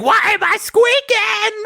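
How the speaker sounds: very high, drawn-out squeal; a gadget wailing in squeaky alarm; high-pitched slow mock wail; squeaking gadget come alive. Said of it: "And they're like".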